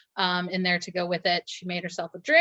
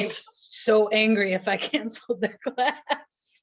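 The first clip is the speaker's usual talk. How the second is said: amused, drawn-out exaggeration breaking into a laugh; real laughing; 3 semitones higher than usual. Real, not acted. Instructor jokes about class members' anger at cancellations, laughing. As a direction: say it through a rising laugh.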